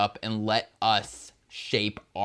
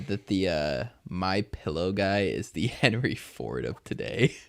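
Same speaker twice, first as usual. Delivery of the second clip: ordinary talk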